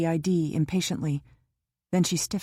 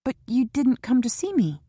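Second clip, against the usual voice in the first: put-on higher, pleading young woman's voice; young Kara, hurt; 6 semitones higher, 3 dB louder than usual. A narrator's reading. Kara asks Jock why he stayed away, hurt and higher. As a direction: push him, wounded and eager. Kara's voice in the novel.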